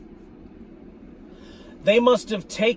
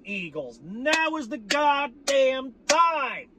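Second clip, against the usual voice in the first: high, slow, drawn-out battle talk; rally the troops, slowly; hyped rally cry; 5 semitones higher than usual. Real, not acted. Fan rallying the Eagles, slow hyped rally cry. Fan hyping team for season.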